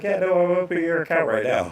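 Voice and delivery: high, fast eager clerk; breezy rushed reassurance; bank lady. Retold or imitated. Bank clerk telling him she's opening it now, high and fast. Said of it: "She's like".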